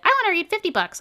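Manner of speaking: high, loud mock-excited brag; mocking overeager reader. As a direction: parody a gushing BookTok fan. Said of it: "I'm not gonna sit here and be like".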